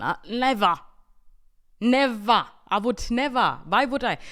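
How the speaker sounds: loud, insistent "never"; indignant denial. Real, not acted. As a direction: hammer the denial home. Denying the accusation of lying.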